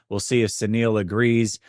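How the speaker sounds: ordinary delivery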